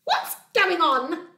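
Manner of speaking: loud, high, stretched-out demand; squirrel's yell. Acted. Squirrel disturbed inside the log, indignant yell. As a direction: yell it as a startled, cross squirrel. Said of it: "yelled a squirrel".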